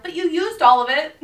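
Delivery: mock-shocked comeback to a customer; incredulous. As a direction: say it in pure disbelief.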